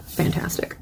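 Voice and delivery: whispered, barely voiced praise; whispered aside. Real, not acted. Lecturer admiring the stanza to herself, whispered. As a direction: say it under your breath.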